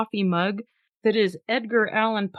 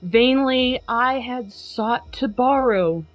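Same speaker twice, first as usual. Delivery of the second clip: slow, drawn-out recital voice; dramatic poem reading; slower than usual. Acted. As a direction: linger on each phrase like a gloomy narrator. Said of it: "a dramatic, if not comical, reading".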